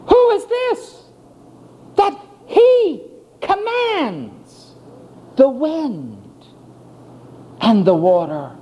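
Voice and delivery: high, slow fearful wonder; awed frightened question. Acted. Disciple awed at Jesus stilling the wind, fearful. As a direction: ask it trembling with awe at the storm's master.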